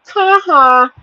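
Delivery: loud, high mocking "ha ha"; taunting devil laugh. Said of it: "devil's just laughing like".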